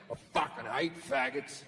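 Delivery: low, quiet, contained anger; trying to calm down. Acted. God outside calming himself down, low contained anger. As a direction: seethe under your breath, reining it in. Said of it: "he's trying to calm himself down".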